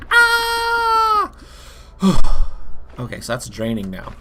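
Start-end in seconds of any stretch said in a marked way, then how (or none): 0.1-1.3 high loud strained cry; gasping cry. Pretend. Running out of air. 1.4-2.9 big relieved breath out; loud exhale. Pretend. Releasing held breath.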